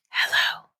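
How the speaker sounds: fully whispered hello, no voice at all; eerie, breath-only answer; whispered reply. A narrator's reading. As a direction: whisper back down the phone line. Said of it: "something whispered back".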